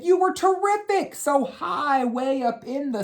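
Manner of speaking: very high at first, sliding down and slowing; blue crown. Acted. Blue crown praises the child's sky coloring, high gushing voice. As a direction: gush with praise, high then softening. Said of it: "And then the blue crown said".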